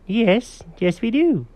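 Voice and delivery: high-pitched storybook cat; high little voice conceding the point; agreeing cat character. Acted. Cat answers dog.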